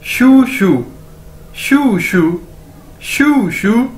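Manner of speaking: "शू शू" like shoe said twice; careful teacher's model of "शू शू"; demonstrated pronunciation. Shown as a demonstration. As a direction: drill "शू शू" for learners, pausing between takes. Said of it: "दो बार इकट्ठा बोला जाए".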